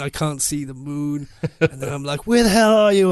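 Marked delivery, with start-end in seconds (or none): 2.3-3.1 loud, higher frustrated yell; shouting. Retold or imitated. Shouting at the girl on the mountain, loud and high. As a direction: call out across the marsh. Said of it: "I'm, like, shouting at this poor girl".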